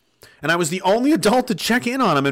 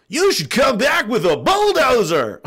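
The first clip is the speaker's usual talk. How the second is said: mock voice; 9 semitones higher, 4 dB louder, slower than usual. Joking advice, mock voice.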